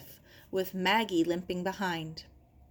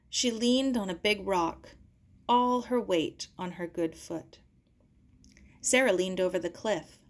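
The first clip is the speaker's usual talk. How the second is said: usual speaking voice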